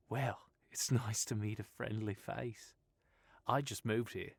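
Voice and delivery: put-on breezy Australian girl voice; easygoing Australian woman reassuring Stephen; Australian accent. Sylvia, friendly, laughing.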